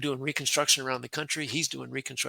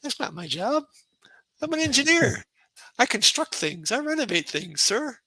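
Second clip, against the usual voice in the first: indignant inner thought; 10 semitones higher, 6 dB louder than usual. Retold or imitated. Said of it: "I'm sitting there thinking".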